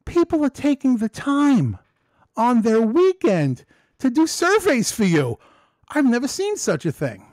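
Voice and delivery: high, loud amazed supervisor voice; high astonished imitation of the boss; quoting the supervisor. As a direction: mimic the boss in shocked disbelief. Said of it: "my supervisor goes".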